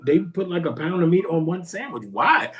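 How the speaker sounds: normal talking voice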